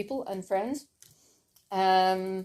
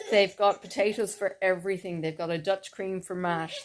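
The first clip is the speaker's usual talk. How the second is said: usual speaking voice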